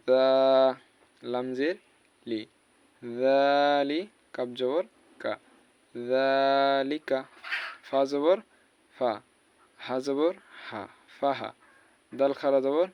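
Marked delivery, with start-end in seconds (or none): 5.9-7.2 জালিকা with the জা drawn out slowly; stretched vowel. Shown as a demonstration. Teacher showing the long জা in জালিকা. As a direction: hold জা in জালিকা one alif long. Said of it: "জাল এক আলিফ টান হবে".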